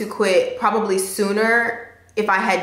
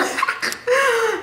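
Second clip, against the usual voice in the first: high, embarrassed laugh breaking the tension; a high laugh at getting emotional. Real, not acted. Laughing at herself after tears.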